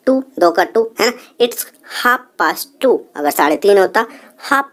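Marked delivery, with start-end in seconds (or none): 1.4-3.0 each word drawn out for the class; slow, careful teacher demo; very slow model phrase. Shown as a demonstration. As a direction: spell it out slowly to learners. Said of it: "teacher models English time".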